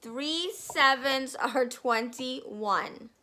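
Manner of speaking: loud, slow, booming bully voice; bullying headmistress. Acted. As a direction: bark it like a tyrant headmistress. Trunchbull bullies the class.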